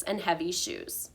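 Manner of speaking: usual speaking voice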